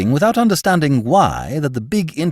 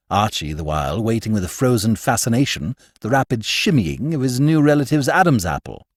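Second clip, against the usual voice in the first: ordinary delivery, nothing marked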